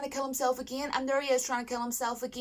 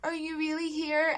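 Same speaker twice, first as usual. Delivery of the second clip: higher, lightly voiced happy amazement; happy disbelief; 6 semitones higher than usual. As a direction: retell his joyful wonder, pitched up. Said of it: "he was so happy".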